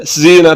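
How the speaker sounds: जीना with a buzzing 'z', zeena; wrong whistling z. Shown as a demonstration. Teacher showing learners the mistake, whistling z. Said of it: "अब ये जा पढ़ा जा रहा है".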